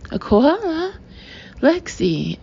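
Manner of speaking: laughing. Acted. Eric laughing at Lexi's koala comparison. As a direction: laugh at the idea. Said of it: "Eric laughs".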